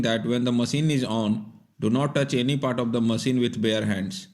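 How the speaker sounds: normal talking voice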